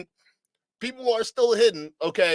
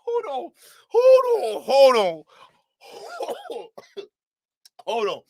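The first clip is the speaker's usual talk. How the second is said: laughing hold on; 7 semitones higher, 5 dB louder than usual. Real, not acted. Streamer laughing, trying to stop.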